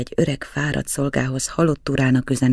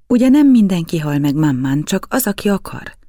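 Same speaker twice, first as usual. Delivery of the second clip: put-on higher, child's piping question; thin child's voice; 5 semitones higher, 5 dB louder than usual. A narrator's reading. Little Krisztián asking his mother, thin child's voice. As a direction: voice a small boy asking innocently. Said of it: "vékony gyerekhangja".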